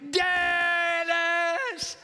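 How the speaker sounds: long, high, loud calling of the name; desperate drawn-out cry for a brother. Retold or imitated. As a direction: wail the name out to God. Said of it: "And I'm crying to God".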